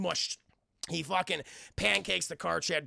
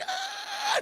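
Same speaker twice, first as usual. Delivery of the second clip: very high, sustained mock scream; electrocuted scream. Willie gets fried by electricity, screaming. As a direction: imitate a long cry of being zapped.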